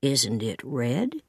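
low, soft and slow; slow, hushed low question; gentle quiet man. A narrator's reading. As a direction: voice a shy man asking carefully.

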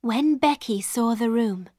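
usual speaking voice

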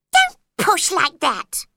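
high, loud little Dormouse voice; squeaky Dormouse. Acted. Dormouse to Alice, high and squeaky. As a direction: squeak out the complaint.

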